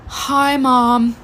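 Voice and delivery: hammed-up weak sigh, then greeting; dramatically sick. Daughter faking illness to her mother, dramatically. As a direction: imitate a child milking a fake illness. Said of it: "like, so dramatically".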